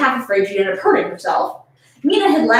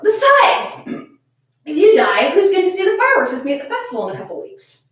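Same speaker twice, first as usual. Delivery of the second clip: higher, straining to be heard; voice cracking high; 7 semitones higher than usual. Acted. Reading Haruka calling over the faucet, high strain.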